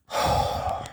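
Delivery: long, audible exhale; heavy sigh without voice; breathy sigh. Retold or imitated. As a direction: let out a long breath of air. Acting out needing to breathe.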